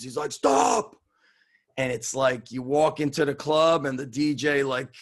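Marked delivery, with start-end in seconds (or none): 0.3-1.0 sudden high, loud yell; retold scream. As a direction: scream it as a one-word command. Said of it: "like, screams".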